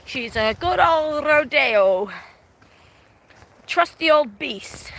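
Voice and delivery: broad Aussie drawl. Retold or imitated. Telling the man about her ute, slow broad Australian drawl. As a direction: drawl it like an old rodeo hand. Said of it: "I said".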